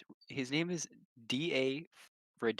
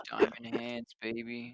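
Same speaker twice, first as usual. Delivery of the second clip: crypto bro. Pretend. Hyping crypto gains with friends.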